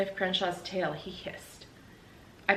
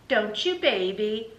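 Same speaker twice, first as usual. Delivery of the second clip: put-on baby talk to dog; 3 semitones higher, 5 dB louder, slower than usual. Talking to the dog.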